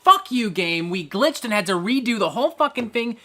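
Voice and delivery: loud cursing at the game; indignant gamer rant; angry. Real, not acted. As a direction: spit out each curse, fed up. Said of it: "I am fucking angry".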